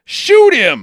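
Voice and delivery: loud command; mock villain command; barked order. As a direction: yell the order at him. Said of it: "Travers goes".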